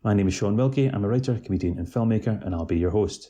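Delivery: loud, projected show opener; podcast host introduction. Acted. Host welcoming listeners to a new podcast, loud announcer delivery.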